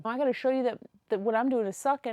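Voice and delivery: ordinary talk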